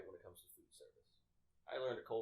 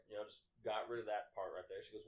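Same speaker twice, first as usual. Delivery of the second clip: ordinary delivery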